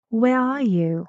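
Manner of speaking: "Where are you" peaking, then falling on "you"; firm question, "you" stepping down; falling intonation. Shown as a demonstration. Teacher repeats the question for pupils, falling "you".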